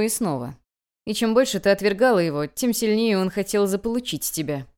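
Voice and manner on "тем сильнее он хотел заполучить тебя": ordinary delivery, nothing marked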